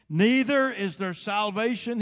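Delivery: loud, high, slow proclamation; booming preacher quoting an apostle; thundering scripture declaration. Acted. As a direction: proclaim the verse at full pulpit force. Declaims verse as Peter would.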